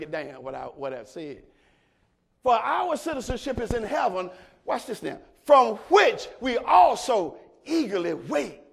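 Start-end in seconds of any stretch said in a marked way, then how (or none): 2.4-8.6 loud, higher, stretched preaching shout; full-force preaching. Acted. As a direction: preach it to the back row, slowing on key words.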